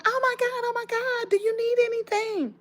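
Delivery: high, fluttery mock-panic woman voice; panicky neighbor. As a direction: squeak it like she's flustered.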